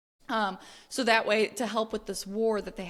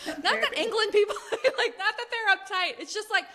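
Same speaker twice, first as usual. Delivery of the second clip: high, giggling backpedal; real laughter while walking it back; laughing; 9 semitones higher than usual. Real, not acted. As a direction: laugh your way through the disclaimer. Laughing backpedal on British comment.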